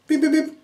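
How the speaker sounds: high mock beeping alert; imitated phone beeps. Voter's phone pings with a vote, high beeps. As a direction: chirp it like a phone notification.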